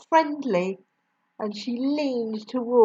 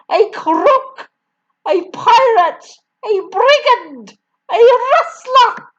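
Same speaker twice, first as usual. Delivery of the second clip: each accusation higher and louder; screaming; 13 semitones higher, 9 dB louder than usual. A narrator's reading. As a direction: scream insult after insult. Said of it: "the Trunchbull screamed".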